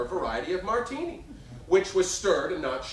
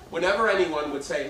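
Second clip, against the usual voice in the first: normal talking voice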